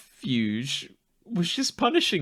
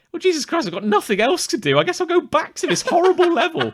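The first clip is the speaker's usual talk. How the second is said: high, strained and a bit louder, half laughing; exasperated mock whine; 11 semitones higher, 4 dB louder than usual. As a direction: whine it out, fed up and defeated. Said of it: "And I go".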